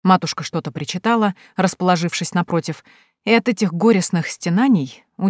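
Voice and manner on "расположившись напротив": ordinary delivery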